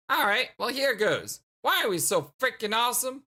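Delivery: lightly voiced young [name].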